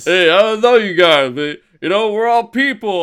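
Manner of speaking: rally the crowd like Rocky; hammed-up heartfelt Rocky address; Rocky speech. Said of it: "where he's like".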